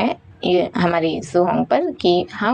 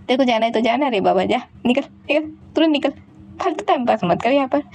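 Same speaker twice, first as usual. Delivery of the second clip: higher, fast, telling her to get out; dismissive snapping; 4 semitones higher, faster than usual. Acted. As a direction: shoo her away rudely. Yunlain tells Juhong to leave.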